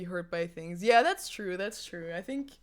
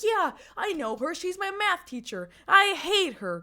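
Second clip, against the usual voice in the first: put-on bratty teen dismissing a teacher, higher and louder; 9 semitones higher, 7 dB louder than usual. Tipsy teen telling a stranger he hates the teacher, bratty. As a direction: toss it off like a careless teenager. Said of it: "'cause I was a teenager".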